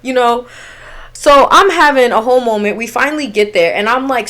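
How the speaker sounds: usual speaking voice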